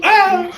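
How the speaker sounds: sudden high-pitched "ah!"; mock startled yelp. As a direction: imitate walking straight into a trap. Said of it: "but you're like".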